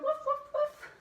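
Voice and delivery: high, puppyish woofs; quick high-pitched yips; dog bark. Shown as a demonstration. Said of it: "bark like a dog".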